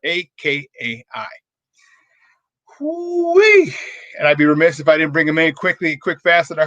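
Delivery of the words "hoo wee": a high, loud hoo-wee whoop; excited whoop. Real, not acted.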